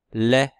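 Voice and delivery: Lait said loudly with a short rise; clear demo word. Shown as a demonstration. Teacher to class, testing the sound, loud and clear. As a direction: call out Lait for the class to listen. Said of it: "Est-ce qu'on entend le et dans lait?".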